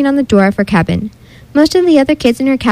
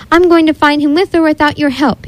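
raised voice, calling away; 5 semitones higher than usual. A narrator's reading. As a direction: call back over the shoulder. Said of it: "he called over his shoulder".

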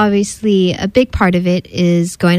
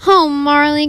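higher, drawn groan of dismay; 4 semitones higher than usual. A narrator's reading. Mommy groans at the messy dog, exasperated groan. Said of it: "groaned Mommy".

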